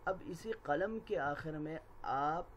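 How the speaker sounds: ordinary talk, nothing marked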